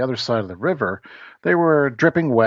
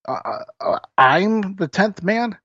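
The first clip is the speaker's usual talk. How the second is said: elated disbelief; 7 semitones higher than usual. Acted. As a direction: gasp it out in joyful disbelief. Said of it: "the leader was elated".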